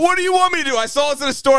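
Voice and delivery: excited protest. Real, not acted. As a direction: defend yourself, wound up. Defending buying own gift.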